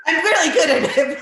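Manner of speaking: giggling as you brag; laughing through it. Real, not acted. Telling the host about her spreadsheet skills, laughing.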